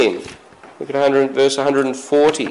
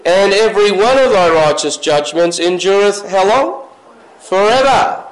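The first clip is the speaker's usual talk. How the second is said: fervent pulpit reading; preaching; 4 semitones higher than usual. Acted. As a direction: pull the congregation into the verse. Preaching scripture with questions.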